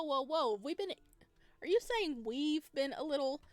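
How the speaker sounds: normal talking voice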